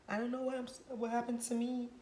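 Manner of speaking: high mocking imitation of the father; lightly mocking whiny echo.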